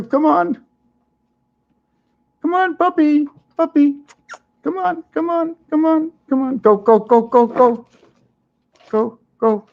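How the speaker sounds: high coaxing pet voice; calling a puppy. Real, not acted. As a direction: call the puppy along, high and bright.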